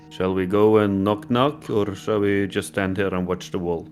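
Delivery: usual speaking voice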